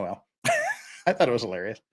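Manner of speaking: ordinary delivery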